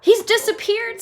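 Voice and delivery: very high, loud alarm; mother alarmed, very high. Acted. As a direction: gasp it out. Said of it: "said Missus Gloop".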